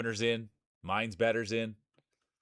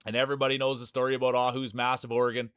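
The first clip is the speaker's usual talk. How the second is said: normal talking voice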